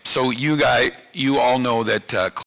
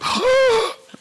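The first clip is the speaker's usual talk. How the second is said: sharp, very high mock gasp of alarm; 4 dB louder than usual. Husband teasing his wife about his wallet, high mock gasp. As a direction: fake a gasp to tease your wife. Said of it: "I would go".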